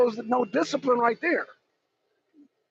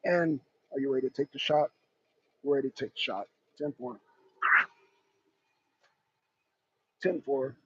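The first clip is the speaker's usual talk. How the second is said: much lower, much softer radio exchange; calm sniper radio; 10 semitones lower, 10 dB quieter than usual. Acted. Snipers on comms taking the shot, low murmur. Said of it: "get our snipers in position".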